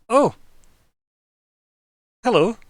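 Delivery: high, loud startled greeting. Acted. Shopkeeper startled by the rat customer, high loud hello. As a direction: jump at the sight of him. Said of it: "Just stares at you, Fleabite. Startled".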